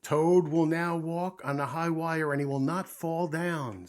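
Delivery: loud, sustained proclamation; announcer voice. A narrator's reading. Voice presents Toad's high wire act, loudly proclaiming. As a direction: ring it out like a circus announcer. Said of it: "said the voice".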